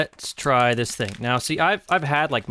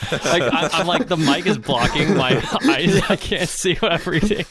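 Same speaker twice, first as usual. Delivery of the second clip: laughing; 6 semitones higher, 4 dB louder than usual. Real, not acted. Laughing at a blocked view.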